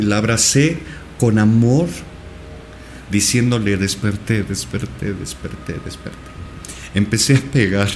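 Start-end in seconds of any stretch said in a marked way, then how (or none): 3.8-6.2 starts plain, each repeat softer until whispered; fading to a whisper. Retold or imitated. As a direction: let each desperté fade away into a whisper. Telling wife he woke up. (cada vez más bajo, hasta un susurro)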